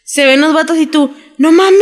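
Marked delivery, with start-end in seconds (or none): 1.4-1.8 loud, high burst of disbelief; shocked exclamation. Retold or imitated. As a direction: blurt it out, much higher and louder. Friend's reaction retold.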